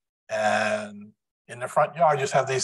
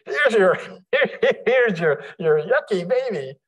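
mock delivery announcement; 8 semitones higher, 4 dB louder than usual. Acting out delivery of baby.

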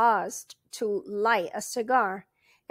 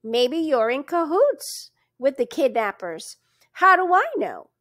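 accusing, rising to a louder challenge; suspicious; 4 semitones higher, 7 dB louder than usual. A narrator's reading. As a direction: constable accuses the stranger of being in cahoots, suspicious. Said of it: "asked the constable suspiciously".

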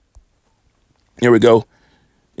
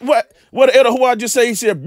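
ordinary talk, nothing marked

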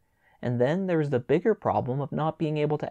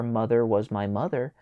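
ordinary delivery

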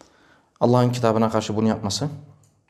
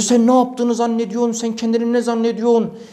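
angry yelling; 8 semitones higher than usual. Retold or imitated. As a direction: berate the young man in anger. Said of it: "Böyle bağırıyor". (öfkeyle azarlayarak bağırma)

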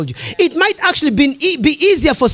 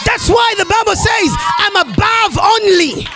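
preaching shout; 6 semitones higher than usual. Real, not acted. Preacher to the congregation, a full shout.

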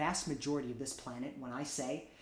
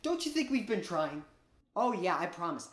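ordinary delivery

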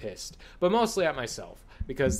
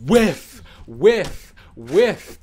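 loud mocking whiff; 5 semitones higher, 10 dB louder, much slower than usual. Mocking the attack miss sound.

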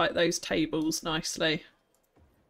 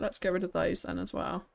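normal talking voice

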